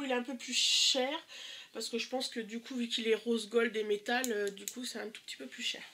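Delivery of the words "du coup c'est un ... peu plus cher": a quick whispered aside on the price. Real, not acted. Confiding the price to viewers, whispered.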